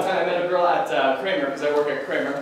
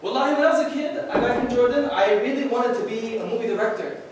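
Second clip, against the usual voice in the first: put-on Arabic-accented uncle; 3 semitones higher than usual. Uncle Nashat voiced in story.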